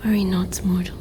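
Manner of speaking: soft, reassuring whisper; whispering comfort to the dying mortal. Acted. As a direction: promise it quietly at their ear. Creature comforts the unconscious human.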